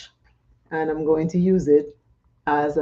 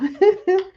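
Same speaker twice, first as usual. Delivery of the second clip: quick high laugh; high giggle; 13 semitones higher, 3 dB louder than usual. Real, not acted. Laughing to viewers while applying makeup.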